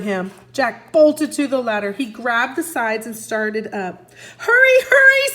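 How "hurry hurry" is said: much higher, louder panicked scream; a girl's panicked scream. A narrator's reading. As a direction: scream at him to hurry. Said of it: "screamed Annie".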